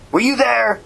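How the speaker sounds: high, challenging question; demanding. A narrator's reading. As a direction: demand an answer. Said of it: "Max demanded".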